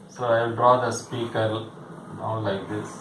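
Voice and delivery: ordinary delivery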